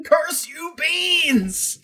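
high hammed-up dramatic curse; mock curse. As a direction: shake a fist at the beans like a melodrama villain.

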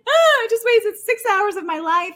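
high mock-sobbing wail; retold hysterical crying. Girl bursting in on poker players, mock hysterical crying. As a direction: wail it, imitating your own tearful outburst. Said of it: "burst in the door hysterically crying".

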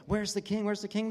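high, eager searching cry; excited crowd. Acted. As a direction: call out as an eager onlooker.

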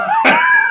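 high shrieking burst of laughter. Real, not acted.

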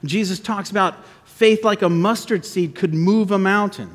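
usual speaking voice

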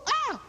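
very high gasping "ah", acted; mock-amazed high yelp; astonished cry. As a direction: cry out as if witnessing a miracle. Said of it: "You go".

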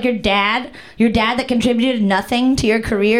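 ordinary talk

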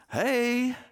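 high, friendly call from the porch; bright greeting. Acted. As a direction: hail the visitors cheerfully. Peter greets guests at porch.

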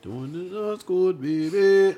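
high-pitched drawl, punched on the last word; viral video imitation. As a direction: stretch it out high like the video catchphrase. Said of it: "that's my favorite video".

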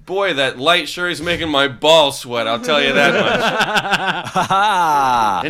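put-on old borscht belt comic. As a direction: play a cheesy eighties stand-up. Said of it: "introduce Musky Peen to the stage".

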